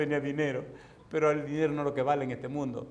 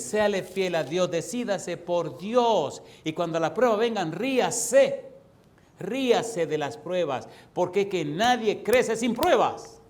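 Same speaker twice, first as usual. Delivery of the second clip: full-force preaching; 3 semitones higher, 4 dB louder, slower than usual. Acted. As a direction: drive each exhortation home to the church. Exhorting congregation at full force. (predicación enérgica y lenta)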